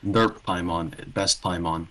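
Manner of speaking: ordinary talk, nothing marked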